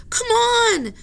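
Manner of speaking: high, loud call; urgent cry. Acted. Jack calls Annie into the crowd, high and loud. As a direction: call her to follow, urgently. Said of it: "Jack cried".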